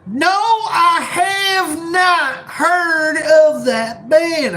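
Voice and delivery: high, very slow fake Southern drawl. Shown as a demonstration. Streamer answers chat in a fake Southern drawl. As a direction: draw out every word in a mock Southern accent. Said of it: "my fake Southern drawl".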